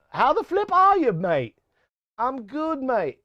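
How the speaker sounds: put-on a rough stab at a South African accent, pitched high; attempted South African accent. Streamer greets a UK viewer in a bad South African accent. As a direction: try a South African accent, half-hearted. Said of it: "gonna try to sound like South African".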